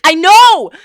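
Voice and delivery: loud, high, drawn-out retort; exasperated shout. Acted. Streamer answers pestering viewers, exasperated shout. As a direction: shout down the naggers. Exasperated reply to mimicked complaints.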